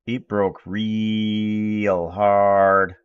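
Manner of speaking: very slow, stretched-out REAL; drawn-out emphasis. Real, not acted. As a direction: drag out "real" for a long beat. Repeat, drawn out for effect.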